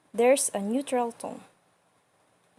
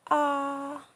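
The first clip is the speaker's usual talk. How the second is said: "Ah" held high and level; "Ah" sustained on one high note; flat first tone; 4 semitones higher than usual. Shown as a demonstration. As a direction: open up and say a long, steady "Ah" as for the dentist. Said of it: "as when you say a dentist".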